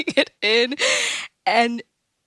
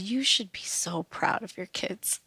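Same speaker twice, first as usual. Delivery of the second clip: whispered pastor line; pastor's praise retold in a whisper; hushed quote; 8 dB quieter than usual. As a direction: breathe it out as a whisper.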